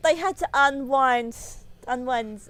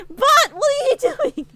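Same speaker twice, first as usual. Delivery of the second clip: high, louder, slow, half-crying plea to the snake; panicked plea; 9 dB louder than usual. Retold or imitated. Telling her pet snake off mid-bite, high panicked plea. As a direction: beg the snake to stop, voice cracking.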